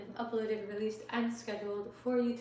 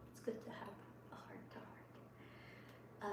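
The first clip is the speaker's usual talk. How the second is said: slow, hushed whisper, barely voiced; soft whisper; 15 dB quieter, slower than usual. Real, not acted. Streamer whispering a tender aside to her viewers, slow soft whisper. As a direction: breathe it out gently and slowly. Tender moment after heart-to-heart chat.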